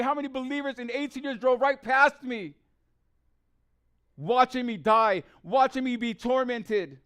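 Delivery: fervent testimony, pressing each accusing question; impassioned preaching. Real, not acted. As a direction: preach it with mounting indignation. Preaches own suffering at full force.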